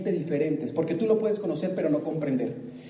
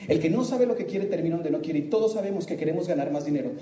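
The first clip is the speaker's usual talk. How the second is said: normal talking voice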